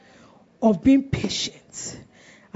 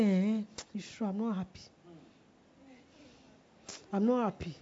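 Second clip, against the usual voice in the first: acted dejection; 2 semitones lower, 9 dB quieter than usual. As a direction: agree softly, as if downhearted. Acting out a gloomy morning mood.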